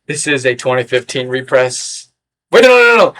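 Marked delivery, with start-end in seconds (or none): 2.5-3.1 high, loud string of no's; sudden high burst of correction; alarmed self-correction. Real, not acted. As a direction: cut yourself off in sudden alarm. Catches own mistake mid-sentence.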